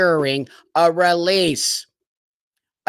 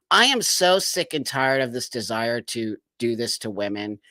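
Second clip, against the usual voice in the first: usual speaking voice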